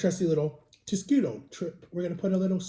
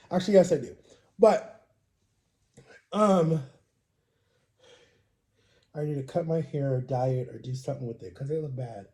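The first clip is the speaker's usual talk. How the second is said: ordinary talk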